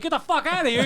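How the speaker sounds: high, hammed-up yell; mock yell. Clinic staff throwing the friend out, mock shout. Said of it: "they're screaming at him".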